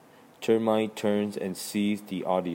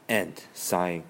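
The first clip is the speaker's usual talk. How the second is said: ordinary delivery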